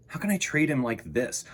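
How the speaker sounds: usual speaking voice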